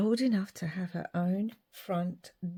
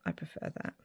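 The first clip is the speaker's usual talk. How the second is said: ordinary talk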